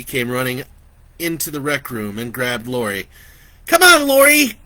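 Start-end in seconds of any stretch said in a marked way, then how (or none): 3.7-4.6 high, loud panicked squeal. Acted. Vicky squeals at Lori to run from the killer. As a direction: squeal it in terror. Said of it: "she squealed".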